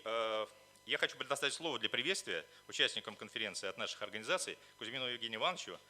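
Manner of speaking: ordinary delivery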